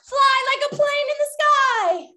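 high, drawn-out game call; playful command. Pretend. Game leader telling children to fly, playful command. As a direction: call it out high, stretching the words.

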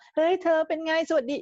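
high-pitched put-on voice for kids; cutesy second voice. Shown as a demonstration. Greeting little children in a put-on second voice, very high. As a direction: chirp it way up high, as if to small children. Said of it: "คุยกันด้วยเสียงสอง".

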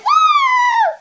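loud high whoop; excited whoop. Real, not acted. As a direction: cheer it out at the top. Dance finale cheer.

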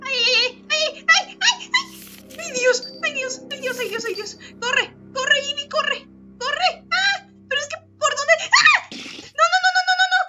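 very high, loud panicked screaming; being chased. Real, not acted. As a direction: shriek frantically, half laughing. (gritando aterrada mientras la persiguen)

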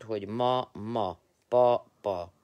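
school-style syllable drill; syllabified. Shown as a demonstration. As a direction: chant the syllables like a first-grade teacher. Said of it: "ha szótagolva írod".

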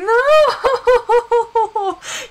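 big burst of high, loud laughter; loud high cackling laugh. Real, not acted. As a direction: crack up loudly, high-pitched.

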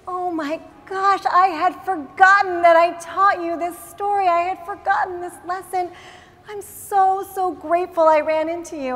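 stunned delight. Acted. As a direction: burst out in grateful amazement. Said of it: "stunned. She couldn't believe it".

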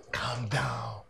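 hushed whisper, keep it from her; whispered warning to a friend. Acted. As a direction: whisper it urgently so nobody hears. Hushing friend about the girl.

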